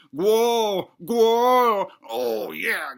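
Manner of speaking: drawn-out hammed-up moans; groaning in pleasure. Husband revels in the realm of curtains, groaning in pleasure. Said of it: "he's just groaning in pleasure".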